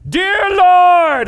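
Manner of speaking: shouted high and loud; full-voiced prayer. Pastor acting Daniel praying boldly, shouted high. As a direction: belt it out like he's going for it. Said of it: "like he is going for it".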